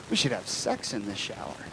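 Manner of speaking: very low, hushed proposal; soft suggestion. Retold or imitated. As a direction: drop right down low and quiet.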